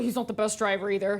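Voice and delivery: ordinary talk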